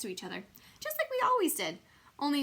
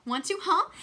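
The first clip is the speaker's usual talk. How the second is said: eager; 9 semitones higher, 7 dB louder than usual. A narrator's reading. Junie B eager to sit by door.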